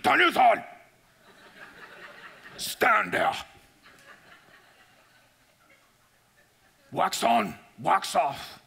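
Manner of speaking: a hint of an old Okinawan master; Miyagi impression. Teacher commanding his student, light Miyagi accent. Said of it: "And he goes".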